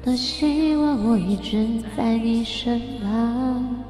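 ordinary talk, nothing marked

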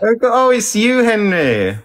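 high, loud cooing exclamation; mock surprise. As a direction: squeal it in playful recognition, laugh at the end. Jokey mock surprise at a teddy bear.

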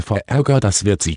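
usual speaking voice

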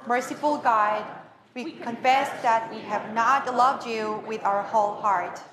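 normal talking voice

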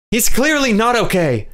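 high, loud angry spit of words, quivering; spat out angrily. A narrator's reading. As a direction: bite the words out in anger. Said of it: "Jonathan spat".

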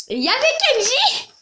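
high, loud burst of excitement; excited exclamation. Real, not acted. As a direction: shout it out thrilled. Mother was right, Kenji there.

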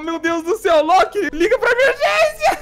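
high, slow, whiny plea; hammed-up tearful alarm; play-acting a desperate call; mock panic. Mock panic over sick dinosaur.

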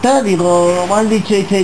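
ordinary talk, nothing marked

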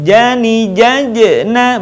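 'jajena' chanted with the second j bouncing into a short vowel; bouncing jim. Shown as a demonstration. Teacher showing the dead letter bouncing, high chanted 'jajena'. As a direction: chant 'Jani, jajena' high, echoing the closed j. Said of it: "suaranya mantul".

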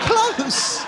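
high, disbelieving close; incredulous. Acted. Repeating the Texan's word in sheer disbelief. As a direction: squeak it out in disbelief.

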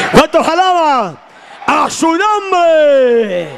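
shouted call. Acted. Preacher calling the congregation to praise, high and shouted. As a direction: raise the call to full voice. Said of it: "Hello, ¿cuántos alaba?".

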